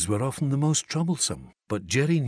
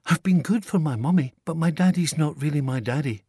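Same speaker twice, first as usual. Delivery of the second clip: put-on higher child's voice, stating it plainly; a small girl reporting facts; matter-of-fact little girl; 6 semitones higher, 4 dB louder than usual. A narrator's reading. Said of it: "said Chloe in a matter-of-fact way".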